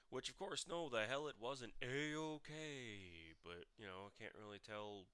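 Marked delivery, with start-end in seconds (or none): 0.1-3.3 drawn-out, louder, higher outrage; slow indignant retort. Real, not acted. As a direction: stretch it out in real indignation. Angry retort about abuse.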